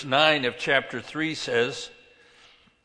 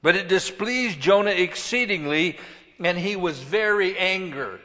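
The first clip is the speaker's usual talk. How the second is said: loud, raised-pitch preaching; emphatic scripture reading; 5 semitones higher, 6 dB louder than usual. A narrator's reading. Preacher reading Jonah's anger to the congregation, emphatic. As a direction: read it out with rising force.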